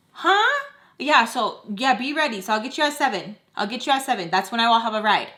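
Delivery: high, loud mock mom on the phone; loud brush-off. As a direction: imitate mom talking right over the question. Voicing mom brushing off the question.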